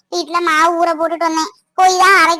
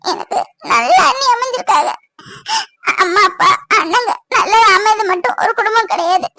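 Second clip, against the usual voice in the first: crying through the words, voice catching; tearful; 4 semitones higher than usual. Acted. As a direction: speak between sobs, with broken pauses. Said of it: "இதுக்கா பூமாரி இப்படி அழுற?". (அழுதுகொண்டே தழுதழுத்த குரலில்)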